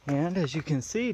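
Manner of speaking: ordinary delivery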